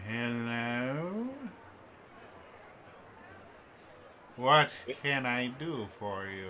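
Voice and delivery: slow, drawn-out shopkeeper with swooping rises; elderly elf shopkeeper. Acted. Odd old elf greets an approaching customer, drawn-out welcome. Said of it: "all of the features just kind of droop".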